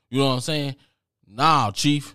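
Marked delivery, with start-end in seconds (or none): 1.3-2.1 loud confronting. Retold or imitated. Confronting the clerk.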